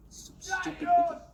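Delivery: sudden loud shout at people outside. Real, not acted. Shouting through the window at noisy people outside. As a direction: yell out at the idiots outside.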